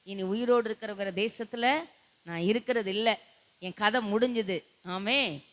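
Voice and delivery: ordinary talk, nothing marked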